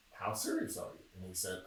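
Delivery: normal talking voice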